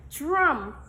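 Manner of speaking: "Drum." said alone, loud and distinct; word demonstration. Shown as a demonstration. Teacher prompts class to say the word, loud distinct "Drum.". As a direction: model "Drum." firmly for repeating. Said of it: "Say the word".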